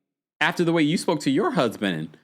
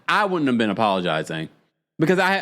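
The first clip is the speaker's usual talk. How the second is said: usual speaking voice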